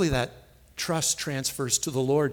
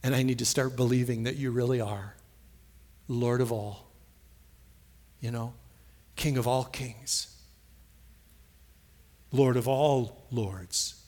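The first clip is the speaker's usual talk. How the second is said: prayer; 4 semitones lower than usual. Acted. Preacher modelling a prayer of surrender to the Lord. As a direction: pray it humbly and earnestly.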